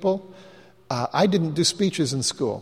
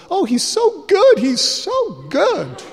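high, slow, delighted woman's voice; imitating the Panama girl's high delighted gush; 13 semitones higher, 4 dB louder, slower than usual. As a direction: gush over him like a thrilled young woman. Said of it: "the girl from Panama goes".

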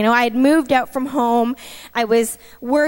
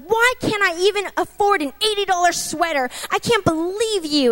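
higher-pitched exasperated protest; indignant complaint, not shouted; retold anger at God; 5 semitones higher than usual. In a store, railing at God over a sweater, exasperated. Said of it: "being so angry with God".

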